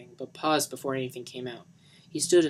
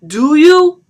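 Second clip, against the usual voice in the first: high, loud insistent demand; shouted prompt; 21 dB louder than usual. Acted. Johnny pressing Lisa for an answer, loud shout. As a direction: push her for an answer. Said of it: "he prompted".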